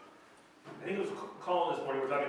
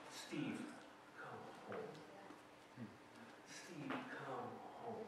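low, hushed call to come home; soft calling; 4 semitones lower, 17 dB quieter, much slower than usual. Retold or imitated. God softly calling the wanderer home, hushed. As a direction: murmur it gently, drawn out.

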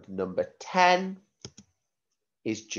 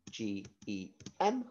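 spelling out letters; 11 dB quieter than usual. Shown as a demonstration. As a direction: spell each letter clearly for the class.

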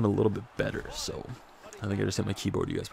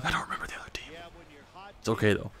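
whispering; 6 semitones higher, 5 dB quieter, faster than usual. Real, not acted. Embarrassed aside to viewers.

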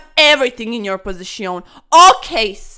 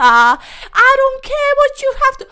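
defiant shout; 13 semitones higher, 8 dB louder than usual. Acted. Defiant at opponent.

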